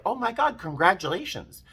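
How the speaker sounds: high, bright burst of joy; excited congratulations. Retold or imitated. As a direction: gush it out delighted. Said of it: "And I was like".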